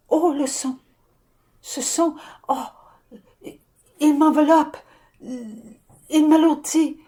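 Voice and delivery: put-on a small child swamped by sound, last words slow. Channelled child overwhelmed by sound.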